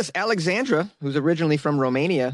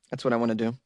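usual speaking voice

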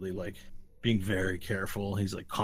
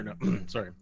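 ordinary talk

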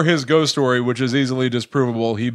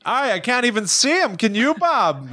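put-on high, bright-eyed Canadian accent; a Canadian hockey fan marvelling in a Canadian accent; 9 semitones higher than usual. A Whitcomb fan talking to Bob, in a Canadian accent. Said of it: "That's Canadian".

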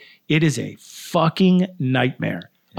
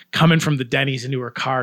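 normal talking voice

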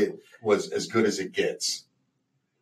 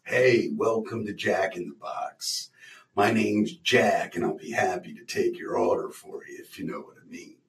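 lightly voiced sleazy Jack; Jack in the Box voice; 4 semitones lower, 6 dB quieter than usual. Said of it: "he's like".

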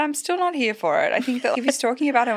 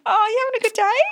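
high, gushing sales patter; gushy saleswoman; 12 semitones higher, faster than usual. Pretend. Pretend fundraiser greeting women callers, gushy and high. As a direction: put on a high, chummy phone voice. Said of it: "What would you do for the women?".

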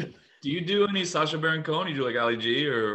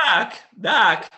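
high, loud one-word yelp; loud, high-pitched Fox impression; Michael J. Fox; 7 semitones higher, 8 dB louder than usual. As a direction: yelp it like a squawk. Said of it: "my impression of Michael J".

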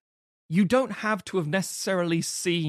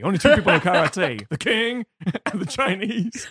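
mock [name]; 5 semitones higher, 5 dB louder than usual. Rambling [name] impression.

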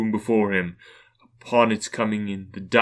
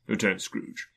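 ordinary delivery

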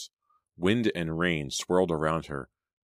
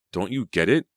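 higher, louder exasperated question; sharp retort; 4 semitones higher, 5 dB louder than usual. A narrator's reading. Selena shot back at Danny, exasperated. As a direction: fire it back at him. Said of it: "Selena shot back".

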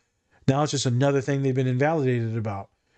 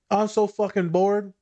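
higher, drawn-out mock complaint; retold moan of boredom, pitched up; imitated bored client; 6 semitones higher, slower than usual. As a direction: whine it slowly like a fed-up client. Client whining in retold argument.

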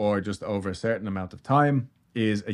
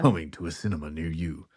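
low, dramatic trailer tagline; movie trailer voice; 5 semitones lower, 5 dB quieter than usual. Pretend. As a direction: sell the film darkly. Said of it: "True crime voice".

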